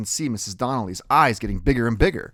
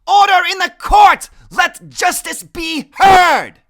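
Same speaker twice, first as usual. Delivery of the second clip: very high, loud commanding shout; 14 dB louder, slower than usual. A narrator's reading. Freak on his desk silencing the class, very loud shout. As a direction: bellow for order like a judge. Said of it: "shouting at the top of his lungs".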